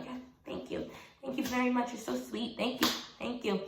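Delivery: tiny, hushed, bashful gratitude; exaggerated meek thanks. Contestant thanking supportive girls, hammed-up soft meekness. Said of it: "well, I didn't say it like that".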